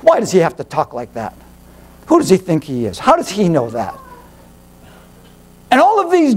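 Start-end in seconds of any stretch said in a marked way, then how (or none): 0.0-3.9 lower, grumbling skeptic voice; mocking critic. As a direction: mimic a sneering doubter, low.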